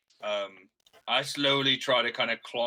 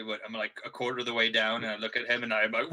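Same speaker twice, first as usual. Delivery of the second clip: ordinary talk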